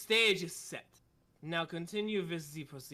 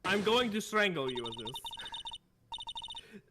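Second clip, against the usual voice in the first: put-on German accent; 3 semitones higher, much slower than usual. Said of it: "It's so hard to do the accent".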